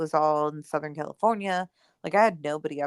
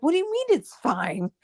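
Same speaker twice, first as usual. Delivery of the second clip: high, loud, disbelieving question; mock-incredulous boss, pitched up; baffled boss; 9 semitones higher, 4 dB louder than usual. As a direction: play the boss who can't believe his ears. Boss baffled by her calm.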